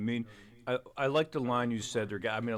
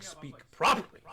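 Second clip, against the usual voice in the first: higher and louder, scolding; mock stern command; 8 semitones higher, 6 dB louder than usual. A father scolding his daughter, higher and louder. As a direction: bark it at the child, higher and louder. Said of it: "I'm like".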